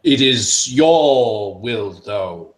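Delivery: loud, slow start that drops away uncertainly; proclaim, then falter; hesitant ruler. Acted. The mantle unsure, offering the group a choice. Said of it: "he's a little bit unsure".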